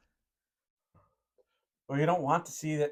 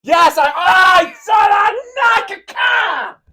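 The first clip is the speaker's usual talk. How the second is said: drawn-out high-pitched scream; 13 dB louder, much slower than usual. Real, not acted. Reacting before Save the Date, screaming. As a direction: shriek it slowly at full volume.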